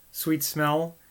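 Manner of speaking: ordinary delivery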